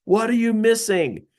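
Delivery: high, insistent mock shout; retold boss's yell. Boss demanding his salesman figure it out, retold yelling. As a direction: hammer it out like an exasperated boss. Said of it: "and he's yelling".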